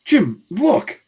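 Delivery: high, loud cry; acted startled alarm; alarmed wife. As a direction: call the husband urgently.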